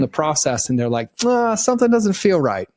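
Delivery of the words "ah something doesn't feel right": uneasy customer. Retold or imitated. Acted doubtful customer backing out.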